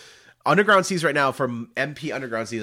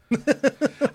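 high burst of laughter. Real, not acted. Laughs at joke.

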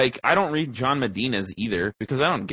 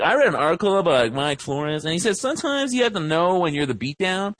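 ordinary talk

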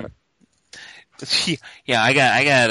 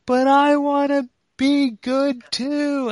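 put-on much higher, very slow wailing little girl; imitating crying daughter; 14 semitones higher, 3 dB louder, much slower than usual. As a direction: stretch it out like a sobbing five-year-old. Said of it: "And she's just crying".

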